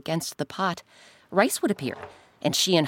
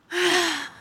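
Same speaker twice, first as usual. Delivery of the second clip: sharp gasp; 12 semitones higher than usual. Acted. Narrator gasps at suspense.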